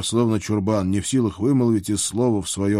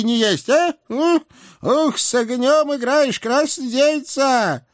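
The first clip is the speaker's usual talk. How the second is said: put-on high, loud scolding old hag; fiery old witch; 4 dB louder than usual. A narrator's reading. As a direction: lay into her furiously. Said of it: "С тем же пылом".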